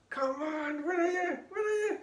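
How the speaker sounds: high, rising calls into empty air; calling out. Retold or imitated. Assistant calling for the vanished magician, high anxious calls. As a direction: call out anxiously for the missing man.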